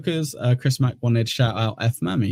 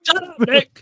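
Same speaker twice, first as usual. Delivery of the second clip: squeaky protest. Real, not acted. Telling friend to shut up.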